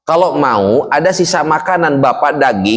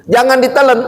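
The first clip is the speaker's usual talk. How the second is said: emphatic warning; 11 semitones higher than usual. Real, not acted. Preacher warns fasting congregation not to swallow, high emphatic call. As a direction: cry out the warning to stop.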